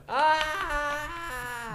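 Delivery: one long, high, continuous mock scream; sustained high "aah" imitating a young screamer; young person's scream. Shown as a demonstration. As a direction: hold a single unbroken cry like a youngster. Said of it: "un joven grita continuado el "ah".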